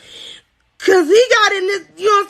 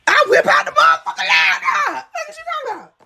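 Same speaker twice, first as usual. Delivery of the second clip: shocked outburst; 7 semitones higher than usual. Real, not acted. Shocked retelling.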